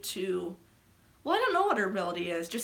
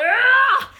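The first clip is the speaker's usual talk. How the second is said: a loud, high straining yell; mock battle roar; 15 dB louder than usual. Thanos hurling a moon at Iron Man, roaring.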